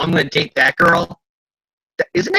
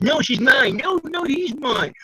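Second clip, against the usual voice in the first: high, whiny back and forth; childish squabble; 11 semitones higher, slower than usual. Retold or imitated. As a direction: bicker like kids. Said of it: "very high school teenagers".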